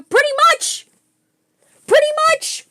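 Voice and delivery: high, loud, emphatic burst; punchy high-pitched outburst of agreement; excited exclamation. Real, not acted. Excited answer about astonishing gift.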